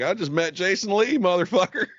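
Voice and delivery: one-up him with a smug crow; answering a friend's brag with a bigger one; mock gloating comeback.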